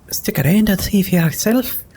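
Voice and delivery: a confident lady knight teasing; voiced Sela, wry; paladin voice. Sela's voiced reply.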